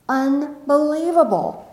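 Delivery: Unbelievable, drawn out high and slow; amazed. Retold or imitated. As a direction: marvel at the birds in the sky. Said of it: "And I'm going".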